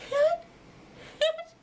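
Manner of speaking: very high, loud strained hoot; croaky hoot. Acted. Owlet trying to hoot, croaking high. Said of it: "she croaked".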